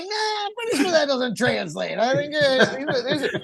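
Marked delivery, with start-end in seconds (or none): 0.0-2.0 mock reply.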